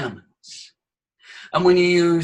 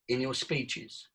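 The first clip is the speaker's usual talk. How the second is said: usual speaking voice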